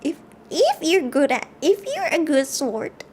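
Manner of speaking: put-on slowly, like a wise old grandmother; a light put-on grandma. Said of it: "I'm figuring my grandma voice".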